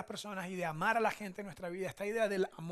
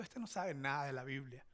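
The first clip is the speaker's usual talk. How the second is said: lower, softer scornful thought, mocking himself; a lower, hushed know-it-all voice; smug inner thought; 5 semitones lower, 5 dB quieter than usual. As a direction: sneer it quietly as his own past arrogance. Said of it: "pero estaba pensando".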